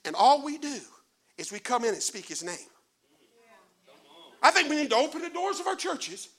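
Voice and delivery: ordinary talk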